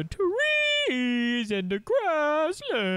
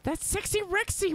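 mock announcement; 12 semitones higher than usual. Showing off favorite dinosaur.

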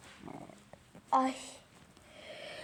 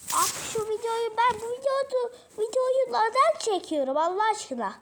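higher, louder whiny complaint; exasperated whine; 7 semitones higher, 4 dB louder than usual. Real, not acted. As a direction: moan it up high in despair. Exasperated complaint about video.

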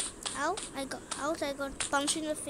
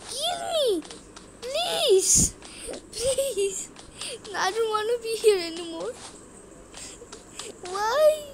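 much higher, much louder hammed-up wail; mock wailing plea; 7 semitones higher, 5 dB louder, slower than usual. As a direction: beg for death in a cartoon despair voice. Character begs after getting hit.

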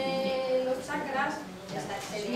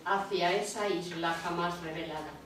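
measured, even poem recital; poetry reading; 5 semitones lower than usual. Acted. Reader sharing the orange chakra sea poem, measured recital. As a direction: read in unhurried phrases, each settling at its end.

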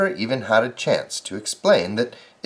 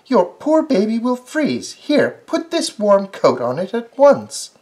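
higher, fussing scold; scolding neighbour; 9 semitones higher, 4 dB louder than usual. Acted. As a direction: fuss over the baby, high. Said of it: "began scolding him".

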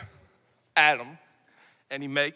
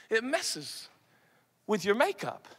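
ordinary talk

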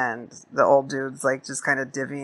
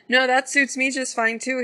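put-on higher old man's voice, agreeable; old man voiced higher, easygoing; old prospector voice; 10 semitones higher than usual. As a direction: play the old-timer going along with it. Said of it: "And he's like".

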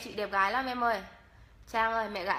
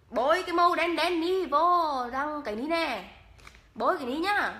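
louder Hà Tĩnh accent; Hà Tĩnh dialect; 2 semitones higher, 3 dB louder than usual. Shown as a demonstration. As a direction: sing out the Hà Tĩnh line. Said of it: "em nói tiếng Hà Tĩnh cho mà nghe".

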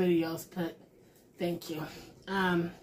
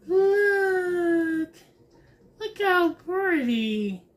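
1 delighted; 8 semitones higher, 5 dB louder, much slower than usual. Real, not acted. Delighted at the card.

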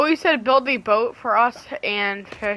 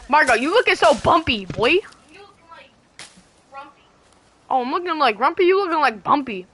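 higher, bouncy mock taunt; teasing sing-song; 6 semitones higher, 4 dB louder than usual. Teasing Margo in voice chat, higher, sing-song mock taunt. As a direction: needle him in a silly playful voice.

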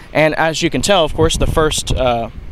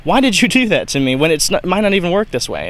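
high, indignant mock protest; a student griping at the teacher, voice jumping up; getting all mad; 5 semitones higher than usual. Retelling his reaction to chemistry class, mock outraged. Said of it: "you get all mad".

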